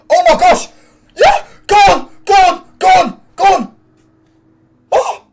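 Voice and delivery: very high, loud screaming; frantic celebration. Real, not acted. Fan urging the team on, frantic excited screaming. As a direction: scream them on in short bursts.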